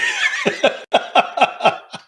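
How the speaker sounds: real high burst of laughter; hearty laugh. Real, not acted. Host laughs reading a comment.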